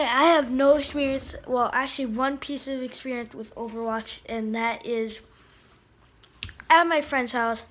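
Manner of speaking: usual speaking voice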